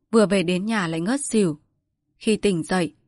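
ordinary talk, nothing marked

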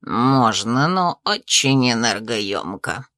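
low, slow spirit voice; otherworldly spirit, low and unhurried. A narrator's reading. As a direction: drop deep and draw the words out like a spirit. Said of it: "ответил дух".

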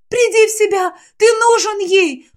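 very high, frantic cries; frantic plea. Acted. Aylet catches her collapsing brother, pleading frantically. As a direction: shake him awake with a frantic plea.

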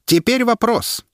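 high, slow proclamation; herald's announcement. A narrator's reading. Herald poses the question to the crowd, slow and high. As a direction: announce it to the whole square.

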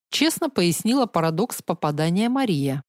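ordinary delivery

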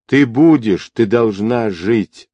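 ordinary delivery, nothing marked

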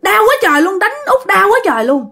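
high-pitched complaint after a hard slap; acted pained scolding. Mother to passenger who hit her back, scolding in pain. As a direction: cry out high, hurt and indignant, without full shouting. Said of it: "Mẹ la".

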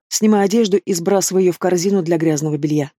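plain reading voice

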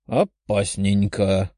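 slow, drawn-out musing; thoughtful demon. Acted. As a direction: stretch it out, pondering the danger. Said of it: "задумался демон".

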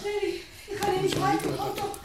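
panicked plea. Acted. Struggling to hold wounded man.

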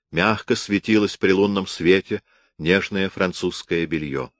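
ordinary delivery, nothing marked

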